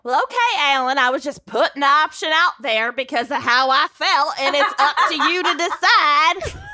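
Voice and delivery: high, hammed-up Texan church lady. Candy leaving the decision to Alan, Texan church lady accent. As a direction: act the wounded Texas belle backing off. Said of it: "Texan church lady murderer accent".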